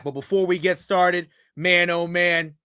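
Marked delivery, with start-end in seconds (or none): none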